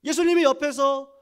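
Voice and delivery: high, loud preaching cadence; full-force preaching. Acted. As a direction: proclaim it to the whole congregation.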